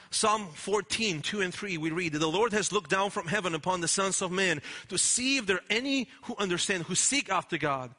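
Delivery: ordinary talk, nothing marked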